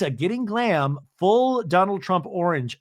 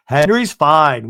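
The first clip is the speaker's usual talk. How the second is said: dismissive Lisa; 8 dB louder, slower than usual. Retold or imitated. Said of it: "now Lisa's like".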